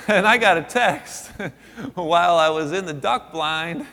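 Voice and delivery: high, laughing through the line; can't help laughing as you tell it. Real, not acted. Laughs telling of surprise text.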